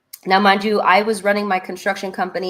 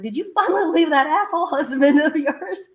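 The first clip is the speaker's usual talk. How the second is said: laughing question; 8 semitones higher than usual. Retold or imitated. Friend asks, laughing.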